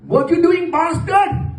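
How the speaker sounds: high, loud mock-furious dad; angry Pakistani dad. Storming into the noisy bedroom, mock furious dad voice. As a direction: bellow it like a dad bursting in. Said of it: "That's his opening line".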